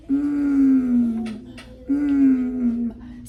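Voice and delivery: "mm" held as a long humming m sound; sound stretched out. Shown as a demonstration. Teacher to class, sounding out the first sound of music as a held hum. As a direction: hum the "mm" and hold it. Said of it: "So the first sound is".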